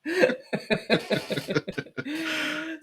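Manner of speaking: agreeing through a laugh; giggling agreement with the co-host. Real, not acted. Still laughing at the joke.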